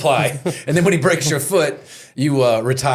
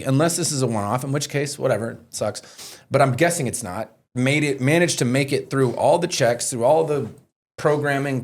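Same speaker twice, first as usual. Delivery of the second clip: ordinary delivery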